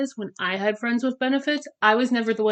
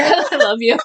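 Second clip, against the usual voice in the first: ordinary talk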